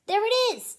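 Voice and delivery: high, loud excited crook; squeaky excited little crook; excited find. Acted. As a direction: point it out with sudden glee. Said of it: "said the short man".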